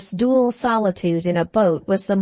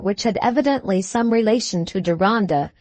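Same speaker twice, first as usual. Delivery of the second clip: ordinary delivery, nothing marked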